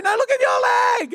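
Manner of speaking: high-pitched insistent warning; hammed-up high holler; mock alarmed yell. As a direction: imitate a panicked mate calling downhill. Imitates friend yelling warning.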